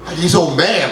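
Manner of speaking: normal talking voice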